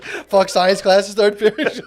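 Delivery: put-on slow, drawn-out excited kid voice; eager kid imitation. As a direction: savor it like a kid who can't wait. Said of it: "eating my breakfast and being like".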